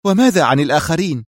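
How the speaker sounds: high, insistent question; tense. A narrator's reading. Sonia asks about the others, tensely. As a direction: press on with a touch of tension. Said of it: "سألته في توتر".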